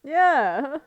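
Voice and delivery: ordinary talk, nothing marked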